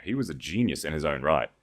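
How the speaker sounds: ordinary delivery, nothing marked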